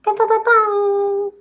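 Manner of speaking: very high, slow sing-song greeting with barks; high goofy bark to greet Sarante; silly sound. Pretend. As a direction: squeal the name and bark like a puppy. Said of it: "I don't know what sound I just made".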